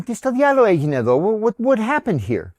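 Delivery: high-pitched retold confrontation, stumbling into the question; agitated demand. Husband confronting the driver at the crash, agitated. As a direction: demand an explanation, pitch jumping up.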